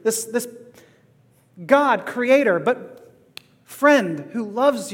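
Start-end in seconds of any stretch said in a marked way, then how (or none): none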